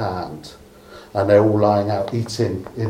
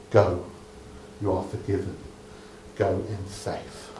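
tender blessing; 4 semitones lower, 11 dB quieter than usual. Acted. Jesus blesses the forgiven woman.

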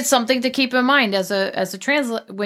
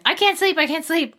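put-on much higher, a little kid's voice; restless child; 9 semitones higher than usual. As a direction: pitch it way up like a child who can't sleep. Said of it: "a dialogue between a kid and the mom".

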